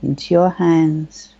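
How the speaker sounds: unhurried, soothing guide, lingering on the key words; guided meditation. Acted. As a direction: lead them gently into calm, phrase by phrase.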